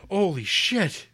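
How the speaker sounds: loud, high exclamation of awe; imitating a stunned fan, pitch leaping up; mock amazement. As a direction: cry it out like a starstruck passerby. Acted awestruck public reaction.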